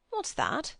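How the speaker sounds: a sharp, high question. A narrator's reading. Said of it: "said Mister Beebe sharply".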